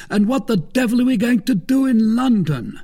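high-pitched, exclaiming question; incredulous cry. A narrator's reading. Porthos asking what they will do in London, incredulous cry. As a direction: demand it in loud-edged disbelief. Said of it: "cried Porthos".

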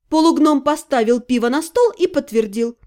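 plain reading voice

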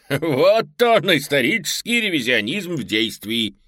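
high, loud giggling dinosaur; giggling raptor. Acted. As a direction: say it through a giggle. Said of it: "хихикает динозавр".

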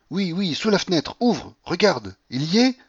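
high, excited bursts of command; frantic urging. A narrator's reading. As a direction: urge someone to look at once. Delirious Paulina urges him to window.

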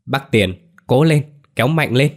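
high, loud urging; loud shout. A narrator's reading. Girl urging the man pulling Nam, loud high shout. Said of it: "Hà hét lớn".

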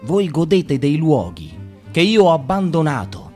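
ordinary talk, nothing marked

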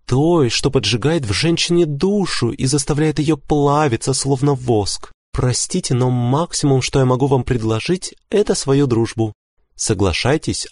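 high, gently firm woman's voice; gentle wife. A narrator's reading. Wife offering husband only friendship, gentle. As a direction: let him down gently, pitched high throughout. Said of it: "мягко сказала".

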